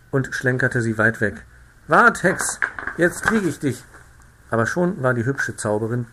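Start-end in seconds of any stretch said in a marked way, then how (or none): 1.9-3.8 higher, louder, teasing threat; playful threat. A narrator's reading. The narrator chases the pretty witch, calling out a threat. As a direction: call after her, half angry, half playful.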